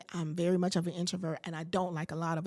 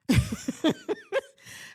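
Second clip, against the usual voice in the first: high shrieking laugh; 5 dB louder than usual. Real, not acted. Hearing she must jump in, a high shrieking laugh. Reacting to jumping in.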